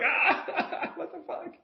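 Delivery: high, laughing outburst. Retold or imitated. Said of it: "You're like".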